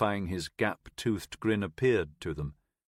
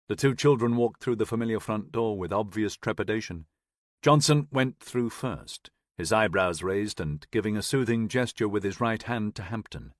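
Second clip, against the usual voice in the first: usual speaking voice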